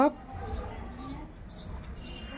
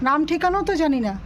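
much higher, gentle question to the dog; coaxing question; 6 semitones higher than usual. Real, not acted. Asking the stray where it lives, gently high. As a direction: ask the dog sweetly.

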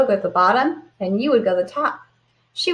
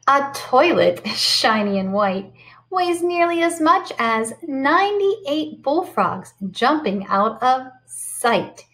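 lively storybook read-aloud; sing-song picture book voice; reading to children; 4 semitones higher, slower than usual. Acted. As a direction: read it bright and animated for the kids.

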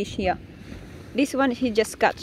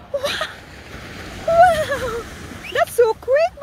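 high, loud squealing wow; delighted cry at the quick catch; excited; 5 dB louder, slower than usual. Real, not acted. Fast catch delights her.

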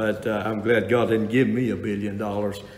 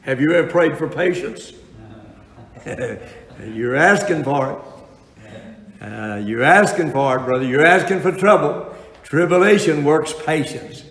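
preaching at full force; 4 semitones higher, 5 dB louder than usual. Acted. Preaching, teasing congregation.

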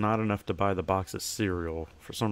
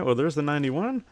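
normal talking voice

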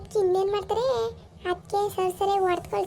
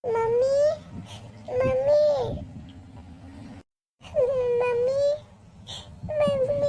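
high, raised calls for mommy; calling out for mom; 6 semitones higher, much slower than usual. Acted. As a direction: call out to mom from the doorway. Daughter keeps calling mother.

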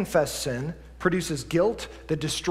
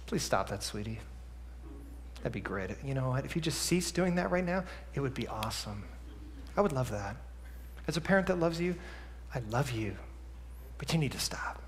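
put-on much softer and lower, sugary calm to a child; quiet, calm voice; 7 semitones lower, 14 dB quieter than usual. Parent calmly asking a misbehaving child to stop, hushed and sweet. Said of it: "in a quiet, calm voice".

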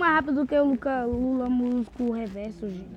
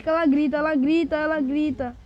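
mild alarm; 4 semitones higher, 7 dB louder than usual. Real, not acted. A personagem grita no jogo.

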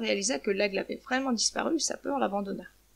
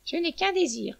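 high-pitched hen voice; lightly acted hen, pitched up; wishful hen; 7 semitones higher than usual. A narrator's reading. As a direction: voice the hen's one wish. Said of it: "dit la poule".

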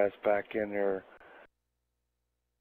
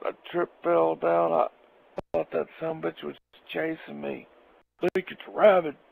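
raised, worked-up buddy voice; voicing Jack; 7 semitones higher than usual. Jack describing the huge skunk, an excited, raised voice. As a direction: spill it like a shaken friend.

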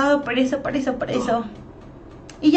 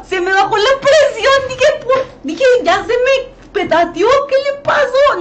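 higher and louder, reliving the scare with a crying edge; retold panic; 10 semitones higher, 4 dB louder than usual. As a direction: tell it as if the fright is happening again, high and loud. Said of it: "Y yo dije". (pánico recordado, más agudo y fuerte, casi llorando)

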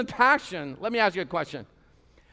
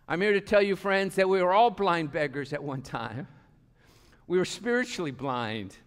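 ordinary delivery

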